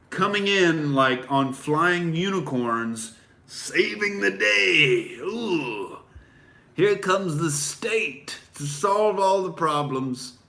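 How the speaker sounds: high, slow, swooning sarcasm; mock starry-eyed awe. Mocking statists, swooning, high and slow sarcasm. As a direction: gush in mock wonder over the saviour state.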